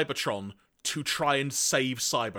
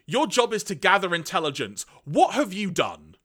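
higher, louder villain scolding; hammed-up angry telling-off; irate; 5 semitones higher, 4 dB louder than usual. Galvatron snapping at Sound Blaster, irate. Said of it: "clearly irate".

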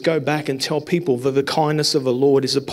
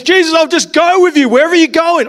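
eager plea; 11 semitones higher, 8 dB louder than usual. Retold or imitated. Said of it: "He says".

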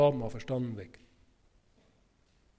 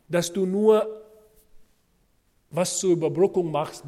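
ordinary talk, nothing marked

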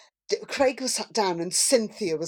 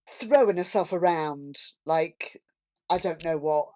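normal talking voice

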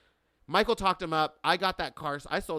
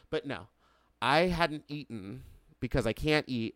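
ordinary talk, nothing marked

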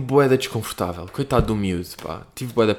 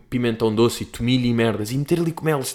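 usual speaking voice

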